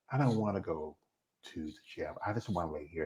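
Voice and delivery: mumbled like someone who won't leave bed; groggy whine. Retold or imitated.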